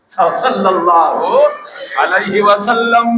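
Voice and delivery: very slow, drawn-out salawat; intoned blessing. Real, not acted. Preacher blesses the Prophet between passages, slow intoning. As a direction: stretch each half of the blessing.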